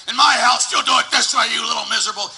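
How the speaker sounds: imitating an angry father scolding his kids; harsh scolding father. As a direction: bark it like a dad ruling his house.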